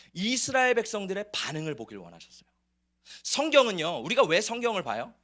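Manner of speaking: ordinary delivery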